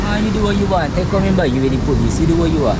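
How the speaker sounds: loud, heated demand for money; furious creditor shouting at debtor; angry shouting. Acted. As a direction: yell at him to pay up. Shouting argument continues.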